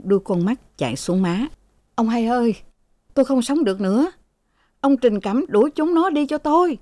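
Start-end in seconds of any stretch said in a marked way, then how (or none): 1.9-6.8 higher-pitched plaintive appeal; acted despairing complaint; desperate plea. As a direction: beg the servant for rescue. Sick father tearfully begs for help.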